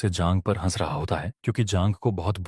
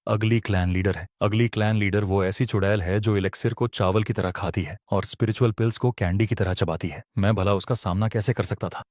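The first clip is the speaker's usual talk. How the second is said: usual speaking voice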